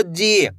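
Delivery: "जी" held long, falling at the end; drawn-out long ee. Shown as a demonstration. Teacher gives learners an example, stretched "जी". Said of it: "खींच के पढ़ोगे".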